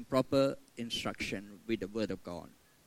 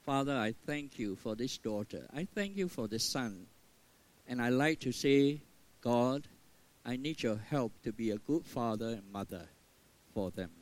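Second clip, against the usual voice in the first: softer, reverent parental prayer; 3 semitones lower, 4 dB quieter than usual. Shown as a demonstration. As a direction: pray over your child gently.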